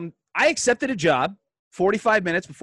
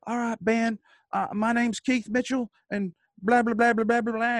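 put-on higher, kindly old-timer voice; old man voice; 9 semitones higher than usual. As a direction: greet the kid like a friendly old veteran. Old man introducing himself.

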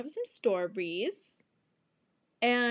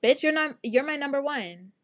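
emphatic friend; 4 semitones higher, 6 dB louder than usual. Retold or imitated. Friend Monica voiced reassuring.

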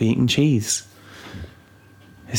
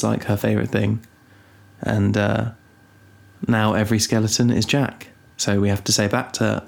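normal talking voice

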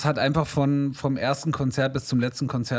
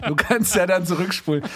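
laughing; 5 semitones higher than usual. Real, not acted. Still laughing at the demo.

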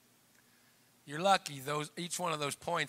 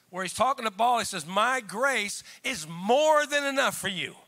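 raised, emphatic preacher's voice; forceful preaching; 5 semitones higher, 5 dB louder than usual. Real, not acted. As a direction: drive it home to the congregation.

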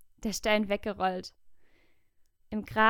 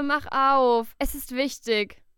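loud urgent call, louder; calling through a door; 4 semitones higher, 7 dB louder, slower than usual. A narrator's reading. Maria calls to Petrus behind the door, loud and urgent. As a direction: shout it through the closed door.

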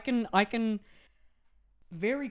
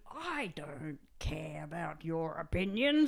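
put-on slow, then higher and louder defiant teen; 6 semitones higher, 4 dB louder, slower than usual. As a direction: sneer it like a bold rebel. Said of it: "Bold meaning".

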